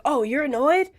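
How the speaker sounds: mock-stern point-docking boyfriend, slow on the penalty; a lightly voiced teacher reprimanding a student; harsh scolding. Shown as a demonstration. Boyfriend docking points from girlfriend, mock harsh. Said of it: "that feels very harsh".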